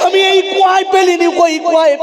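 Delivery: father's shout. Acted. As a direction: shout it down to the son in anguish. Father shouts at son by well. (ছেলেকে চিৎকার করে বলা বাবা)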